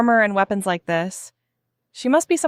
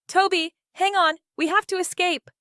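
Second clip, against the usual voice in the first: crying; 7 semitones higher than usual. A narrator's reading.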